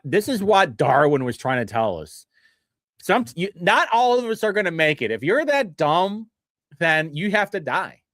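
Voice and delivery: ordinary talk, nothing marked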